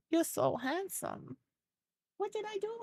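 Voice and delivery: dote on the dog. Real, not acted. Cooing at her pet.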